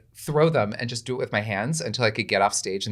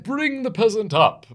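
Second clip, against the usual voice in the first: higher, slow, lightly stagey royal command; King Arthur; 12 semitones higher, 4 dB louder, slower than usual. Retold or imitated. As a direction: order the peasant up like a stage king. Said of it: "And he's like".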